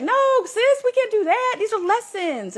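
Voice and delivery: put-on way up high, pleading with her inner child; squeaky, earnest no-sis voice; high-pitched pleading. Talking her inner child out of burning the library, high and pleading.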